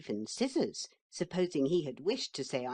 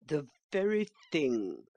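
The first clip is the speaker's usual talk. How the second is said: a touch louder, pouncing horse; eager cry; 3 dB louder than usual. A narrator's reading. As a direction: seize the chance.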